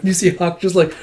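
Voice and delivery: ordinary talk, nothing marked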